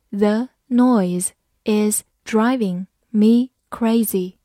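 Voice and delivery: slowly, one word at a time; slow reading. Shown as a demonstration. Teacher reading an example once for students, slow and separated. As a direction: spell the sentence out word by word, unhurried. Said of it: "好，慢读一遍".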